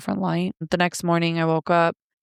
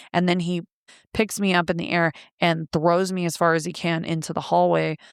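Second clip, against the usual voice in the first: normal talking voice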